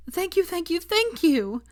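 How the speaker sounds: very high, breathy whispered thanks; overjoyed gratitude. A narrator's reading. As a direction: gush thanks mid-hug. Hugging Neville.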